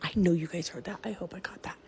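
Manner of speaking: frightened hushed whisper; scared. Real, not acted. Woman whispering to viewers about a noise she heard, scared. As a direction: whisper it, too scared to speak up. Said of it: "This is scaring me".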